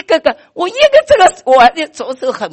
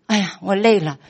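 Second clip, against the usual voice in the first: tired inner voice; 4 semitones lower, 7 dB quieter than usual. Retold or imitated. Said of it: "我想".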